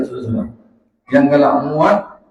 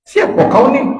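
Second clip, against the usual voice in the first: high, loud startled shout; alarmed husband's loud call; shouting down the well; 10 semitones higher, 4 dB louder than usual. Acted. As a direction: yell down into the well at the stranger.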